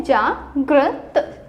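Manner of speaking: जागृत drawn out slowly, ending on a rise; जागृत, slow and deliberate; slow clear pronunciation. Shown as a demonstration. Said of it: "यहाँ पे क्या है?".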